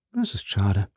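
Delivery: coolly understated reply; a touch of cold detachment. A narrator's reading. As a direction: answer with cool indifference, not raising the voice. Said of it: "antwortete Athos kalt".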